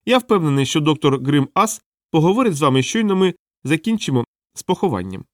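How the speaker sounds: high-pitched clown assuring visitors; small, obliging clown; helpful little clown. Acted. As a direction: reassure them in a high clown voice. Clown addresses the watchmen.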